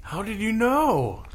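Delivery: high, startled question; surprised. Acted. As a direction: ask it astonished. Player voicing turtle, surprised.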